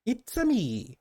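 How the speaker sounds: high-pitched cartoon Italian catchphrase; exaggerated Italian accent. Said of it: "The very over-exaggerated Italian accent".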